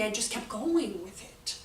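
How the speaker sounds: usual speaking voice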